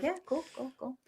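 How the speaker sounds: airy high cool-guy brush-off; mock cool. To his manager after the screening, a breezy mock-cool reply. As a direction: play it cool, high and thin. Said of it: "but I'm like".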